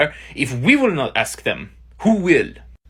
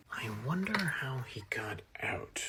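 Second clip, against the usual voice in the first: a hushed whisper under the breath; 4 semitones lower, 18 dB quieter than usual. Acted. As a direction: whisper it to yourself. Aside in a tense scene.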